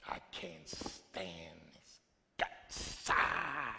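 low, slow, muttered resentment; resentful mutter. Retold or imitated. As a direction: voice a bitter, smoldering grudge. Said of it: "It's a smoldering resentment".

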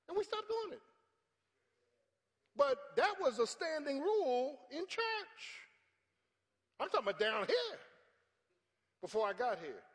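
high, loud, ringing preacher voice; preaching. Real, not acted. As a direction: drive the point home to the pews. Preaching at full force.

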